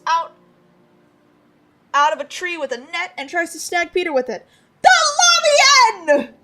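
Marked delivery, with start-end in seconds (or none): 4.8-6.3 high, loud, slow battle cry; triumphant pouncing yell. Acted. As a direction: shout as you pounce. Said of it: "yells Squidly".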